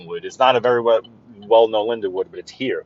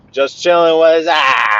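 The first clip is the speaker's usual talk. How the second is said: calling out; 5 semitones higher, 5 dB louder, slower than usual. Real, not acted. Spots a friend's spot, calls out.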